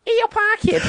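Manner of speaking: very high mock Scouse; squeaky-high caricature of a Scouse accent. Shown as a demonstration.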